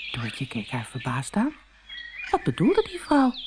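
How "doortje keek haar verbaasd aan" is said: plain reading voice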